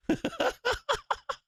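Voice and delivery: sudden high-pitched giggle of disbelief; high giddy laugh. Real, not acted. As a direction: burst into a high squealing laugh. Price drops, trader cackles.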